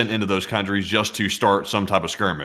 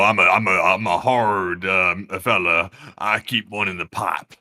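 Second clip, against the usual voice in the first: mock tough guy; 3 semitones lower than usual. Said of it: "joke boast".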